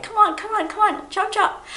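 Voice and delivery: high, urgent parent call; hurrying the kids. Retold or imitated. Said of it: "It's like".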